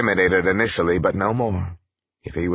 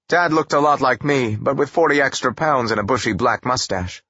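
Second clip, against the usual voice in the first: plain reading voice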